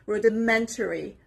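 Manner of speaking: "rudimentary" with MEN stressed; stress on third syllable. Shown as a demonstration. Teacher modelling a word for learners, third syllable stressed. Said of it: "The stress is on the third part".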